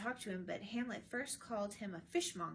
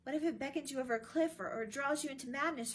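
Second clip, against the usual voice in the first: higher, louder, fast anxious warning; 5 semitones higher, 5 dB louder, faster than usual. A narrator's reading. Friend warning the prince off the ghost, fast and higher. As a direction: rush the warning out. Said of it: "Horatio warned".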